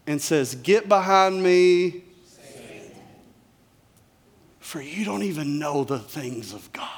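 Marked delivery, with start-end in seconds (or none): 0.6-2.0 forceful rebuke. Acted. Jesus rebukes Peter. 2.3-6.9 whispered, unvoiced rebuke; hushed rebuke. Jesus rebuking Peter, in a stern whisper.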